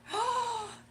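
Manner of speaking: high, sharp gasp of surprise; excited gasp. Real, not acted. Streamer realizes she is one sub away, excited gasp.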